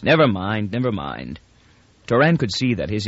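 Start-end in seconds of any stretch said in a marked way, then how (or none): 0.7-1.4 much lower and softer, trailing off; muttered under the breath, fading; fading repeat. A narrator's reading. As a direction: let the second never mind die away. Repeats never mind, trailing off.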